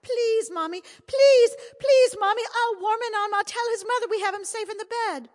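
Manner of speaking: high, loud sobbing pleas; a small boy begging through tears; high, loud tearful begging; crying boy pleading. A narrator's reading. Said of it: "Now Pat started to cry".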